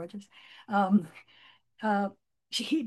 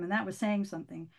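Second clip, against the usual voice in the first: usual speaking voice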